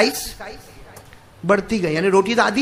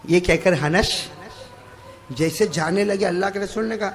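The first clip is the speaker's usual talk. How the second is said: ordinary delivery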